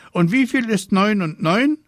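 put-on high, loud little-girl quizzer; high-pitched child's challenge; quizzing sister. A narrator's reading. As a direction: pipe the sum up high like a pestering sister. Sister quizzing brother in story.

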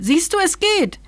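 very high, triumphant little creature; shrill cry. A narrator's reading. Dream eater proving to the king it works, shrill. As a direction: crow shrilly in delight. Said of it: "schrie es schrill".

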